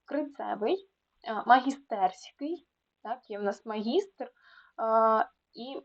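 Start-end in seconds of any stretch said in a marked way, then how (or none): none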